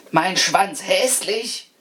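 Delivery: slow, high indignant screech. A narrator's reading. Offended rat questioning the householder, screeching. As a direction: draw it out high in outrage. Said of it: "kreischte die Ratte".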